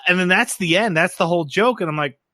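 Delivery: ordinary delivery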